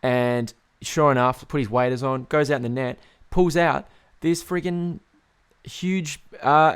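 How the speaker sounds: usual speaking voice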